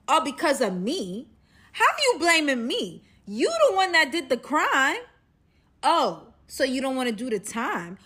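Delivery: loud, high, acted outrage; indignant comeback. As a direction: throw the blame back loudly.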